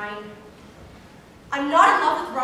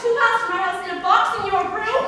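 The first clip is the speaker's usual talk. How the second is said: shouting back; 4 semitones higher, 7 dB louder, faster than usual. Acted. Shouting quarrel continues.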